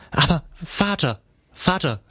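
high, distressed plea; pleading call. A narrator's reading. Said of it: "rief sie".

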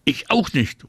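mildly shouted agreement; calling out. A narrator's reading. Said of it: "ruft Anna".

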